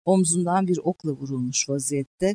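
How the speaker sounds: ordinary delivery, nothing marked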